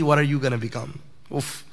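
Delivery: ordinary talk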